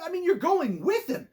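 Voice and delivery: normal talking voice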